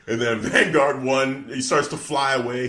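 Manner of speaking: ordinary delivery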